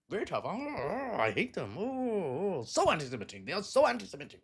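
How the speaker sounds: hammed-up moaning, swooping high and back down; exaggerated pretend disgust with wailing "oh"s; mock outrage. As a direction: parody a politician's fake indignation. Mocking a politician's feigned outrage.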